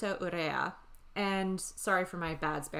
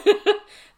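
loud laugh; 14 dB louder than usual. Real, not acted. Big laugh.

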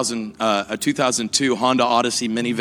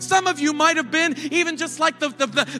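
higher, building sermon climax; preaching at full force; 10 semitones higher than usual. Acted. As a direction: preach it rising to the congregation.

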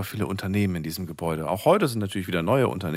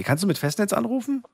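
to someone hard of hearing; 12 semitones higher than usual. Real, not acted. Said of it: "mit Handy".

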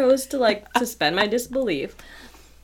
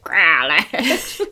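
monster voice; 4 semitones lower, 3 dB louder than usual. Pretend. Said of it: "sounds like a fucking monster".

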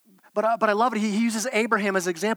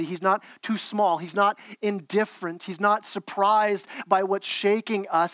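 ordinary talk, nothing marked

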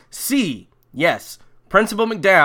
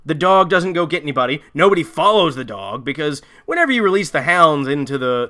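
normal talking voice

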